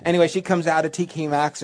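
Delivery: ordinary talk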